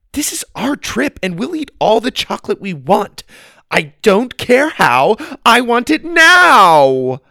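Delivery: building to a spoiled shout; a brat screaming for it now; bratty demand. Acted. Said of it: "the familiar command".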